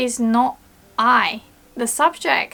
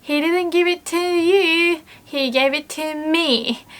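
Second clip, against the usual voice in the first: petty, insistent "to me"; emphasis on who gave it; 6 semitones higher than usual. Shown as a demonstration. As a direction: stress on "me" in "he gave it to me". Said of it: "It sounds like".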